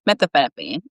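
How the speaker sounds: usual speaking voice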